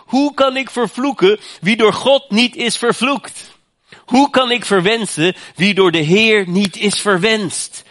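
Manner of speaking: loud, high proclaiming recitation; preaching oracle. Acted. Preacher proclaims Biljam's blessing to the congregation, loud and high. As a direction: declaim the prophecy with force. Reads Bileam's oracle in full force.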